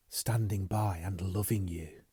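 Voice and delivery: slow, soft whispered reply; tender whisper. Acted. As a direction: answer him softly, with love.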